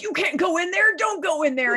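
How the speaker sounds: high, loud, alarmed warning; urgent warning. Retold or imitated. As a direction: warn her off, insistent. Friend warns her off.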